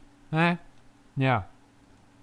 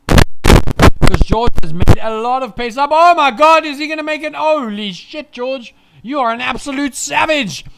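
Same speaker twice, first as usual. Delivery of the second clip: excited shouting; 5 semitones higher, 7 dB louder than usual. Real, not acted. Stunned by George's move.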